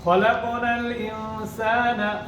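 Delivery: very slow, drawn-out recitation; slow melodic Arabic, bouncing the ক in খলাক; Quran recitation. Shown as a demonstration. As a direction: stretch the verse out as in recitation. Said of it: "আবার দেখেন".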